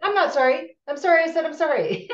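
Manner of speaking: high, loud acted protest; mock self-correction. Retelling her accidental apology while crying, high mock-exasperation. Acting out catching own reflexive apology.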